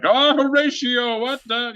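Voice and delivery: high, slow, hammy stage actor; overdone Shakespearean actor; mock actor. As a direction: ham it up like a theatre luvvie. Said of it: "seeing people be like".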